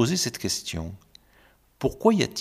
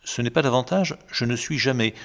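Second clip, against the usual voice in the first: ordinary delivery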